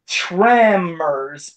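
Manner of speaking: tremors stretched very slowly on the first syllable; over-enunciated, slow-motion "tremors"; slow, exaggerated TREMORS. Shown as a demonstration. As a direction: draw out the "trem" in tremors. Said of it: "not pronouncing it that slow".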